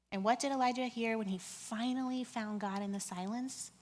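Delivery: normal talking voice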